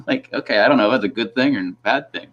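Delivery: ordinary delivery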